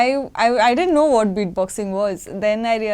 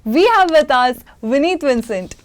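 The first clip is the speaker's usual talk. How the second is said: bright, loud announcer-style welcome; upbeat host introduction; show host intro; 5 semitones higher, 5 dB louder than usual. Acted. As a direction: present the guest like a show opener.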